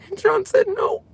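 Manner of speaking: choked-up, tearful voice breaking high; fighting back tears as she speaks; crying. Real, not acted. Breaking down recalling her husband Sean, crying. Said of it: "I'm crying like a little baby".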